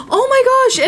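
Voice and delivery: high, startled oh my gosh; surprised gasp. Real, not acted. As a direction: blurt it out in real shock. Sudden surprise at game event.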